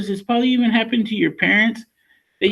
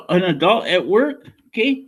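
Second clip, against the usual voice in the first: normal talking voice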